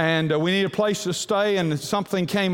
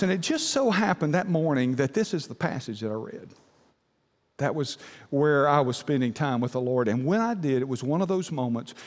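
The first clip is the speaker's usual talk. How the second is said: ordinary talk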